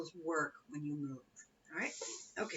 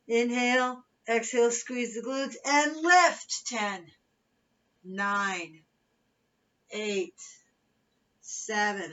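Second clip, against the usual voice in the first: aerobics instructor; 3 semitones higher, 8 dB louder, slower than usual. Acted. Fitness cueing and countdown.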